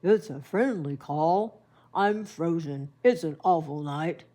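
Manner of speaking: low, loud, booming bear; grandma voicing the bear asking to come in; big bear voice. A narrator's reading. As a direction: rumble it out like a friendly giant bear. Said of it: "But Bear said".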